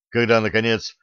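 usual speaking voice

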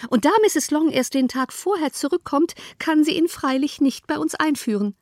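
a fretful mother setting out her complaint; fussy mother. A narrator's reading.

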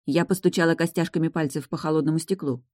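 plain reading voice

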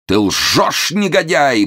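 high, loud moaned accusation; anguished groan. A narrator's reading. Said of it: "простонал Момус". (стонет с надрывом и возмущением)

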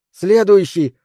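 short, high, loud gasping call; breathless shout. Acted. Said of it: "задыхаясь, крикнула она". (крик, задыхаясь)

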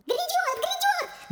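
tiny, extremely high squeal; unexpectedly thin cry. A narrator's reading. Cracked statue prophesying as it topples, thin squeal. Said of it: "крикнув неожиданно тонким голосом".

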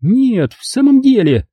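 high indignant outburst. Acted. Mother protests at Kurt's joke, high and indignant. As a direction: bristle with indignation. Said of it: "возмутилась мама".

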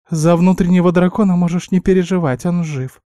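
high small-animal voice, reassuring; reassuring guinea pig. A narrator's reading. Guinea pig assuring him the dragon lives, high voice. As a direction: squeak it gently but surely. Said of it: "заверила меня Винни".

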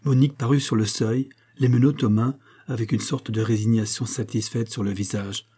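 ordinary delivery, nothing marked

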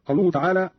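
usual speaking voice

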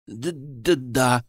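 frightened stammer. A narrator's reading. Terrified servant stammers an answer to the agent. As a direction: trip over the word, too scared to answer. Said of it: "испуганно пролепетал слуга".

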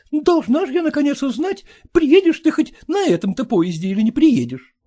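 high, fussy scolding wife; nagging wife. Acted. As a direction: nag and fuss, pitched high. Wife nagging, then to daughter.

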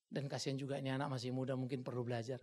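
ordinary talk

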